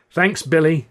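ordinary delivery

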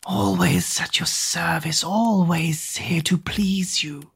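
slow hushed groveling whisper; whispery servant. Acted. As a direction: grovel softly. Googe's voice.

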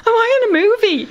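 high, incredulous question; bewildered woman in a crisis; stunned disbelief. Retold or imitated. Disbelief during attack.

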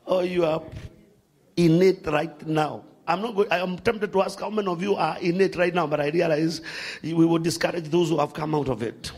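ordinary talk, nothing marked

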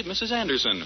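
ordinary talk, nothing marked

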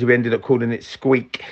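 usual speaking voice

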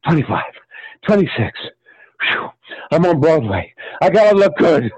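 mock exertion, puffing; lightly acted effort; straining at push-ups. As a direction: count as if mid push-up. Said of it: "doing push-ups".

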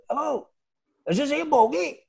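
high-pitched imitation of a phone caller; Danny Begora. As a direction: put on an odd, high caller's voice, checking who answered. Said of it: "it was Danny Begora".

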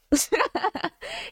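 a burst of shy laughter; high laugh. Real, not acted. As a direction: laugh bashfully at your own thanks. Laugh after thanking listeners.

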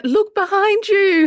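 audience warning shout. Retold or imitated. Audience yelling at the horror heroine, high and loud. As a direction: warn the heroine she's in danger. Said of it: "And everyone's like".

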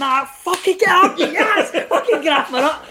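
half-held-back triumphant shout; victory yell. Retold or imitated. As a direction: celebrate the late winner at the screen. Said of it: "had to really rein it in".

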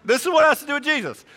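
high, quick, through laughter; amused, loud and high-pitched; laughing. Real, not acted. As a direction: get it out while cracking up. Laughing after own joke.

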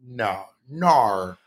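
broad Aussie "nar" for no; mock Australian accent. Shown as a demonstration. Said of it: "As they say in Australia".

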